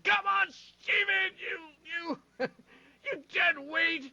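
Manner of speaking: high, strained, drawn-out heckle; mock sideline yelling. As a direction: berate a kid from the stands. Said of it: "you're the one saying".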